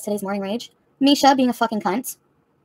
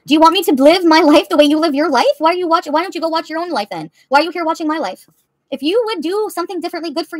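angry; 6 semitones higher, 5 dB louder than usual. Real, not acted. Yelling at chatter.

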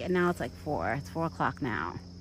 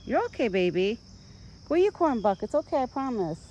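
soothing pet talk; 7 semitones higher, 4 dB louder than usual. Real, not acted. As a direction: coax the frightened deer back to his food. Calming scared deer to eat.

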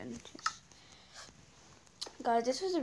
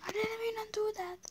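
whispered, much higher pitch; breathy high whisper of regret; high whispered repeat; 11 semitones higher than usual. Real, not acted. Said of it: "Oh god, no".

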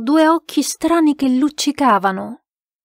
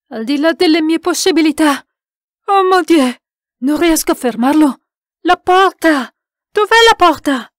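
high, loud, desperate; frantic raised voice; panicked cries; 6 semitones higher, 6 dB louder than usual. A narrator's reading. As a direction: cry out in helpless alarm. Frightened men seek the door.

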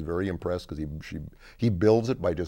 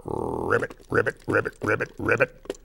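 rolled ribbits that quicken into a string; trilled frog calls; frog croak; 3 semitones higher than usual. Acted. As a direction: croak like a frog, then repeat rapidly. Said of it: "it's going".

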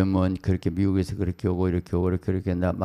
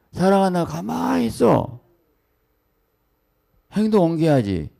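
high, rousing preacher's challenge; fervent exhortation; 10 semitones higher, 3 dB louder than usual. Real, not acted. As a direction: urge them to act, voice climbing high. (높은 음으로 힘차게 다그치는 설교)

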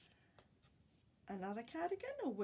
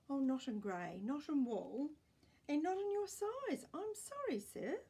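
salesman refusal; 6 semitones higher, 4 dB louder than usual. Acted. Salesman turning grandpa down.

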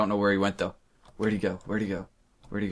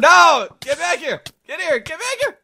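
much higher, yelling after an enemy; excited shout; 9 dB louder, slower than usual. Real, not acted. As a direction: shout at him to come back. Chasing enemy in game.

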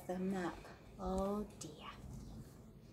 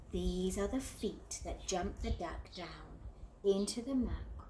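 usual speaking voice

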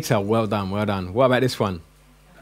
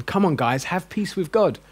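coaxing Paul; 6 semitones higher than usual. Retold or imitated. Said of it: "Is Paul saying".

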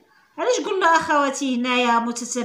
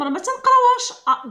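ما تنقراوهاش said high and sharp; stress on the warning; 12 semitones higher, 3 dB louder, much faster than usual. Shown as a demonstration. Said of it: "ردوا بالكم".

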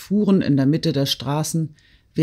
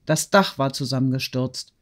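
usual speaking voice

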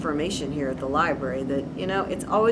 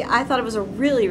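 plain reading voice

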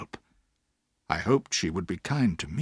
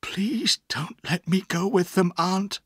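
breathy, higher-pitched plea; pleading child; begging; 8 semitones higher, slower than usual. A narrator's reading. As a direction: beg her in a half-whispered, high voice. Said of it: "I begged".

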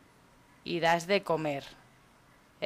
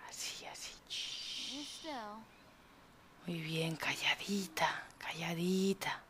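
put-on hushed, slow whisper to a baby; soothing whisper; 12 dB quieter, slower than usual. Player soothes the crying baby quiet, whispering.

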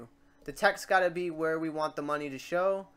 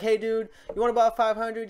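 brighter and louder mock offer, up a bit in pitch; Cloud asking to lend money; 6 semitones higher, 8 dB louder than usual. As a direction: pitch the loan like a pushy buddy. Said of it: "So it's like".

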